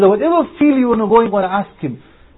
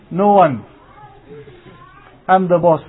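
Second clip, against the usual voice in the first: normal talking voice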